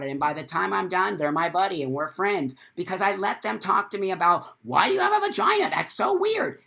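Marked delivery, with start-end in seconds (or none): 4.6-6.6 high, fast imitation of a puzzled guy; squeaky mock bewilderment; mocking gym guy. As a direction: mimic a baffled gym bro. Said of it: "They say that to me all the time".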